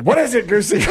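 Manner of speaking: high, hammed-up question to the goose; mock sleepy owner. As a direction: play the dopey owner talking to his goose.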